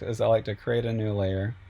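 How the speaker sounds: usual speaking voice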